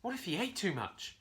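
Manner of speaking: ordinary delivery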